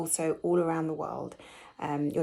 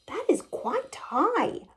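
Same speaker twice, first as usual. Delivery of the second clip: put-on much higher, slow thoughtful child; high, slow impressed little hare; 12 semitones higher than usual.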